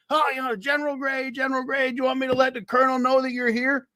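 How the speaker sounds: high, fawning lieutenant voice; nervous, eager young officer. Retold or imitated. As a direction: suck up to the general in a high voice. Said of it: "he comes up and he says".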